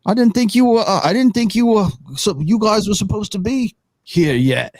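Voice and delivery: strained, high-pushed character voice; deep-voiced figure. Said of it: "very deep voice".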